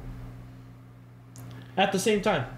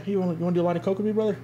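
very fast, slurred coworker offer; quick pitch; 3 semitones higher, much faster than usual. Pretend. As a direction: rattle it off like a coked-up coworker. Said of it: "porta potties".

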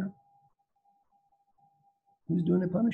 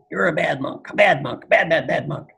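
scolding monk; 7 dB louder than usual. Acted. Angry monk scolds the other monk.

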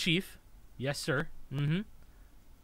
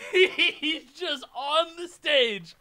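higher, slow, laughing so hard it breaks; laughing, near tears; 5 semitones higher, 6 dB quieter, much slower than usual. Real, not acted. Gamer watching his band member on stage, laughing helplessly. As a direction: repeat it through helpless laughter.

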